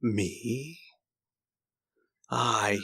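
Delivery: a hint of a gloating killer, kept soft; villain's taunt. Old swordsman excusing the killing, soft and smug. Villain answers who are you.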